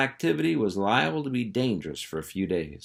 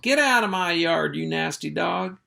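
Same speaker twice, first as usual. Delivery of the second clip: higher, louder angry yell; squalling woman; 9 semitones higher, 3 dB louder than usual. Acted. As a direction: shriek at the dog to get out.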